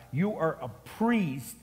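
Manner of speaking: usual speaking voice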